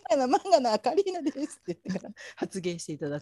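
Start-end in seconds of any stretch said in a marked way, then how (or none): 0.1-1.6 mock Kansai self-introduction, higher at first; Karina. Imagining the guest announcing herself before speaking, hammed-up Kansai accent. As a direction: lay on a playful Kansai accent. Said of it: "関西色を出して喋ってもらう".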